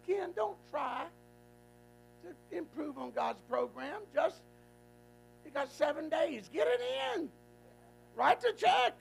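slow then rising mock urgent rebuke; pastor's firm advice, rising high; retold rebuke. Preacher retelling his rebuke to Ken, slow then high. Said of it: "I said".

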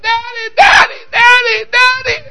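put-on very high, squealing child's call; excited little girl. Daughter dancing out to greet her father, excited. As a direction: cry out like a delighted daughter.

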